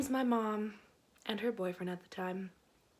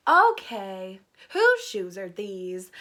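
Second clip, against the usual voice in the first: louder, slow demanding question; stern mom; 3 semitones lower, 9 dB louder, much slower than usual. Retold or imitated. Mom finding a boy's shoes, demanding and stern. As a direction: mom interrogating, slow and firm. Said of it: "and she goes".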